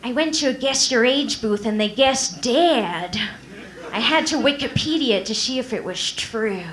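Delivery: sad lateral-lisp [name] voice; sigh, then lisp it out mournfully; slushy lisp. Pretend. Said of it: "she'd be like".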